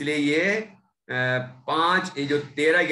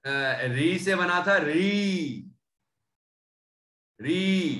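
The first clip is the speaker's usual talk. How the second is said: slow, drawn-out री; teacher spelling out री; slow demonstration; 3 semitones higher, much slower than usual. Shown as a demonstration. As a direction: sound out री slowly. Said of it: "अब देखना".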